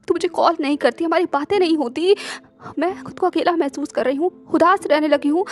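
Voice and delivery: ordinary delivery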